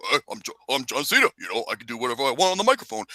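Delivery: a quick, dismissive John Cena send-up; mocking John Cena. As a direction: rattle it off like a smug Cena. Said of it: "was John Cena being".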